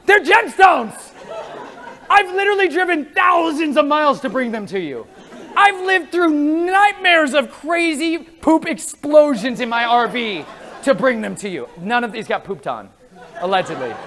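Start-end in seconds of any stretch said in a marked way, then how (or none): 0.1-11.7 exasperated laughing outburst. Retold or imitated. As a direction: shout it out, laughing in disbelief. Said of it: "I'm like".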